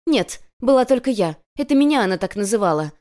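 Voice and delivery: loud, heated outburst starting high; yelling. A narrator's reading. Girl reveals she was the so-called brother, yelling. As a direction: yell the truth at her. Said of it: "заорала Сашка".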